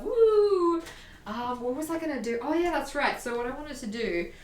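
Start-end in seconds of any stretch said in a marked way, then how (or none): 0.0-0.8 excited whoop. Real, not acted. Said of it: "Upbeatify myself".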